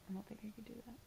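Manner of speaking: very soft, mostly whispered admission; near whisper. Real, not acted. Admitting they couldn't do such rough work, near whisper. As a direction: drop to a half-whisper, as if to yourself. Reacting to something that sounds rough.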